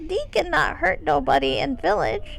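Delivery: lightly voiced kobold, broken English; rough kobold voice. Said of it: "keep the roughness in my voice".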